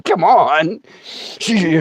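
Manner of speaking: usual speaking voice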